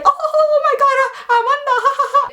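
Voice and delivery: very high-pitched mock guffawing through the words; hammed-up family cracking up at you; imitated laughing relatives. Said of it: "so many people laughing".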